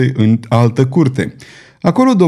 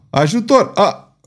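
a high cry for help, cut off; yelling for help; 8 semitones higher than usual. A narrator's reading. Comtois calling the patrol for help, high yell. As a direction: scream for help until struck silent. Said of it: "urlă Comtois".

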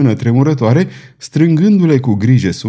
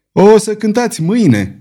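a sharp raised shout, higher; 8 semitones higher than usual. A narrator's reading. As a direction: call out over the psalm-singing. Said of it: "strigă Pardaion".

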